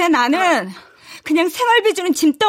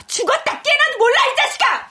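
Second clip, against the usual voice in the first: higher, angry retort; angry snap; 8 semitones higher than usual. Acted. Sister snaps at her younger brother, angry. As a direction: lash out at him.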